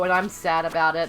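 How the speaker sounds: low, lightly put-on sad admission; Beta Me. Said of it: "that's my Beta Me impression".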